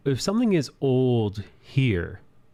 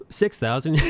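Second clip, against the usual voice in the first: laughing; 7 semitones higher than usual. Real, not acted. Laughing at own exaggeration.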